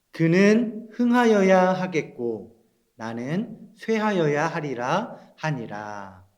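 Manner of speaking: put-on slow, deliberate read-along for children. Shown as a demonstration. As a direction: read the verse slowly, phrase by phrase. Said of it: "천천히 다시 읽어볼게요". (천천히 또박또박 읽기)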